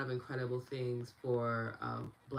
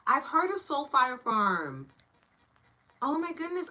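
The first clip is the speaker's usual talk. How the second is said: excited; 12 semitones higher, 9 dB louder than usual. Real, not acted. Excited recognition.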